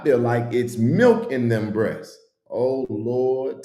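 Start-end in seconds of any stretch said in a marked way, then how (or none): none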